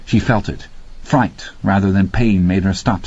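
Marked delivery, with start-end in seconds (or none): none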